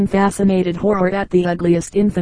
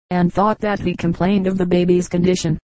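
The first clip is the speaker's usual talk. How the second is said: plain reading voice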